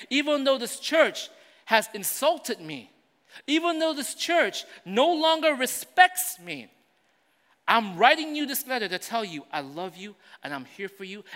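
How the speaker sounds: high, loud, driving preacher voice; loud sermon delivery building through the list; full-force preaching. Acted. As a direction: preach it out to the whole congregation. Voicing Paul's letter, preaching.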